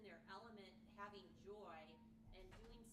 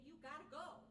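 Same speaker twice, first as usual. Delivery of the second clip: urgent coworkers; 8 semitones higher, 6 dB louder than usual. Retold or imitated. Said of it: "in my office saying".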